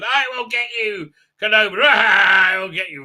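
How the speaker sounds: hammed-up vengeful shouting; mock villain threat. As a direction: shout after an escaping enemy. Villain yells after departing shuttle.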